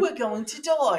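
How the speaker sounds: high, slow, exaggerated British kid; British accent. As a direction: drag it out in a mock British accent. Joins in the British kid impression.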